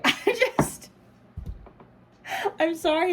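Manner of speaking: high, loud laughing apology; overcome, laughing it off; laughing through tears. Real, not acted. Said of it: "I'm gonna cry".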